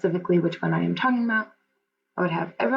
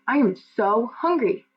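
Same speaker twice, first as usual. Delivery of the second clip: drawn-out, higher-pitched emphatic reading; read with emotion; 5 semitones higher, slower than usual. Shown as a demonstration. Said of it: "and I would read it".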